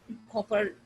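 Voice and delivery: ordinary talk, nothing marked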